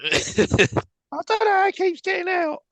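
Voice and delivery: very high, slow falsetto; baffled pet owner. Pretend. Pet owner telling the fireman about an escape, mock baffled. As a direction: fret in a silly squeaky put-on voice.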